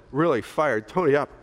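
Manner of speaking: ordinary delivery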